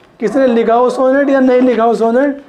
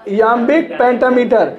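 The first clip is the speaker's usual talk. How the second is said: slow dictation; much slower than usual. Shown as a demonstration. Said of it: "वो लाइनें लिखी कैसे जाएंगी?".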